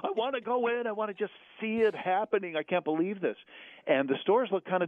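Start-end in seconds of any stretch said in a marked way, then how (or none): 0.0-3.4 high, wide-eyed amazement, slowing at first; excited. Retold or imitated. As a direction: gush like a kid seeing it first time. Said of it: "and I'm like".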